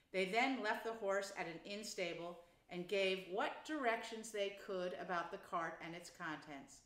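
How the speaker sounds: usual speaking voice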